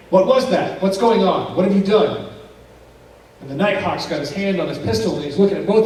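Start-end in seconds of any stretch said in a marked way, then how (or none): 0.1-2.3 loud, higher accusing questions; alarmed questioning. Retold or imitated. Kelly glaring back at Daryl after the bang, loud and accusing. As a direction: throw the alarm right back at him.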